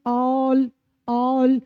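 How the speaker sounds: all all, drawn out very slowly for copying; model pronunciation. Shown as a demonstration. Teacher modelling all for students, very slowly. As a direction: stretch all so students can copy it. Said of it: "जैसे".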